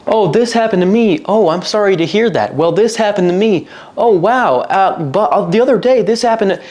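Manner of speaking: high, breezy parody of chatter; sing-song fake interest, pitched high; mock small talk. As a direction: mimic two people not listening. Mocks self-centred small talk.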